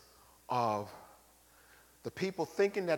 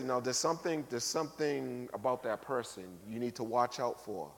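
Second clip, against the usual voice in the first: quiet warning; 4 semitones lower, 6 dB quieter than usual. Retold or imitated. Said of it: "another person would say".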